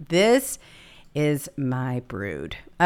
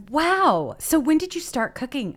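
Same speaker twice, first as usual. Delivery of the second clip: surprised friends; 12 semitones higher, 3 dB louder than usual. Retold or imitated. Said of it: "They're like".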